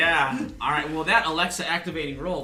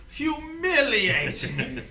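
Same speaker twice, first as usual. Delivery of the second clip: disdainful drawn-out sneer; 12 semitones higher, 6 dB louder, much slower than usual. Acted. Ruben voiced by game master.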